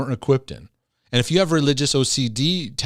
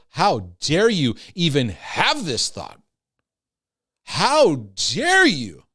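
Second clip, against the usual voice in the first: slow, stern, accusing, building louder; harsh judgment voice; 3 semitones higher, 3 dB louder, much slower than usual. Acted. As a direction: condemn them word by word. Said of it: "I feel mean just even acting that out".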